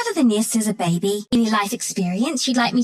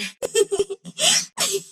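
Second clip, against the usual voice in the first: high, rattling peals of laughter; gleeful cackle; 11 semitones higher than usual. Acted. Gets to kill him again, high gleeful cackle. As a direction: cackle high with wicked glee.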